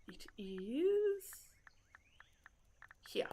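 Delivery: breathy whispered found-it; hushed whisper. Real, not acted. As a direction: whisper it to yourself. Whispered while searching.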